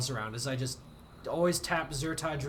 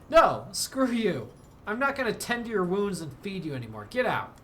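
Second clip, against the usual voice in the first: indignant dismissal, louder and higher; angry outburst; 4 semitones higher, 4 dB louder than usual. Real, not acted. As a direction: throw her out in a huff. Kicking the colonist out.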